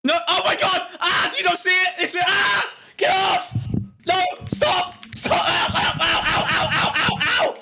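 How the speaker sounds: high, frantic yelping; panicked attack. Pretend. Streamer fights off an invisible attacker, high panicked cries. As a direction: cry out in pain under a flurry of blows. Acted attack by an unseen creature.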